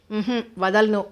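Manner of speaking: ordinary delivery, nothing marked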